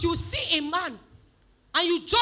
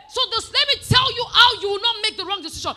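loud, raised, emphatic sermon voice; full-force preaching; 6 semitones higher, 11 dB louder than usual. Real, not acted. Preacher teaching on fruit of the Spirit, forceful and loud. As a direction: drive each phrase home to the congregation.

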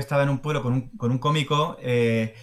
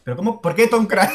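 loud, high, incredulous question; baffled protest; 5 semitones higher, 7 dB louder than usual. Real, not acted. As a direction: exclaim in comic disbelief. Mock exasperation at odd pronunciation.

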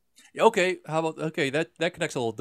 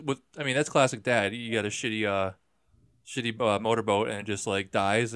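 ordinary delivery

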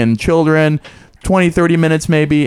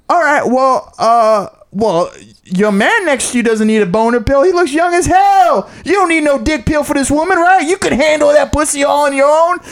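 much higher mock shouting; comic teasing the son from the stage; comic roasting; 12 semitones higher than usual. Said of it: "the comedian goes".

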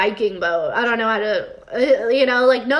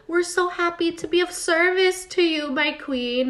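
higher, mock-devoted servant voice; fake servile gladness; 6 semitones higher than usual. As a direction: lay on insincere devotion to a queen. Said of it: "[name] is such a liar".

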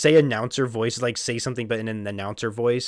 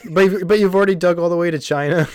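laughing; 5 semitones higher, 5 dB louder than usual. Real, not acted. Laughing at the joke.

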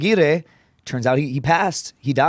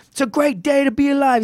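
much higher voice imitating the old coach; high imitation of a football coach; coach's voice; 12 semitones higher than usual. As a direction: play the coach, pitched way up. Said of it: "he'd be like".

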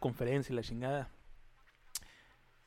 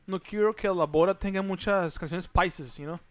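gringo speaking Spanish; imitating an American speaking Spanish; American accent Spanish; 3 semitones higher, 4 dB louder than usual. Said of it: "hablaba bien raro de".

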